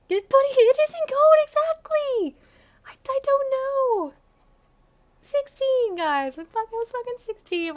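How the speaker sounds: high, loud, strained squeal; embarrassed squeal. Real, not acted. Defending her teen writing to viewers, squealing. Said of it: "embarrassed outburst".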